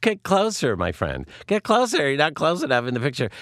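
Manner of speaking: high, hammed-up bossy photographer; exaggerated friend's voice. Friend directing two people for a photo, exaggerated voice. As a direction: imitate a pushy friend, pitch leaping up. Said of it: "she doesn't talk like that".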